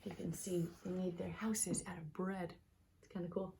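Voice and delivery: soft whisper to the listeners; whispered aside. Real, not acted. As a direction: lean in and whisper it. Quiet aside to viewers mid-story.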